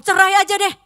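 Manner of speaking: loud, high heated blurt; mock angry outburst. Spouse blurting divorce in anger, loud and high. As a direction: snap it out in a fight. Quoted quarrel line.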